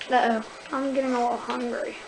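normal talking voice

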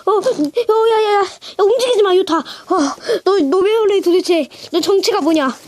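high, flustered pleading; flustered panic. Real, not acted. Reviewer begging the toy Utah not to move, high and flustered. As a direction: panic as it starts slipping.

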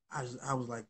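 ordinary talk

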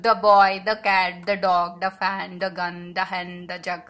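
each "the" a short "thuh". Shown as a demonstration. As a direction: list them with "the" as a weak schwa before the consonant. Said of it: "ద అని అంటాం".

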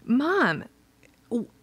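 put-on high, upset little-girl voice; imitated heartbroken child. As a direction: mimic a child broken up over this. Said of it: "Kirby is just broken".

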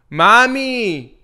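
playful domestic holler; calling from the kitchen. Acted. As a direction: call out sweetly, drawing out the pet name. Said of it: "te lo digo a ti desde la cocina".

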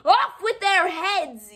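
high, loud, slow furious queen; Queen of Hearts raging at the gardeners; yelling Queen of Hearts. Acted. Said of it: "yelled the queen".

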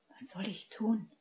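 soft unvoiced helpless whisper; quiet whisper. A narrator's reading. Helpless Hermine asks Severus what to do, whispering. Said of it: "fragte sie leise".

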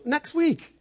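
high, sing-out imitated reply; acted-out husband answering in a high voice; mock breezy answer. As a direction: pipe it up high, unsuspecting. Voices pastor's cheerful unsuspecting answer.

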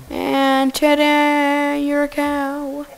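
drawn-out showman reveal; mock fanfare. Mock grand announcement.

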